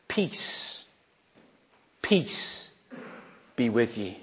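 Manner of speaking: soft, near-whispered blessing, slow with pauses; hushed peace greeting. Acted. Jesus calms his frightened disciples with a hushed blessing. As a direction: breathe the words of peace gently.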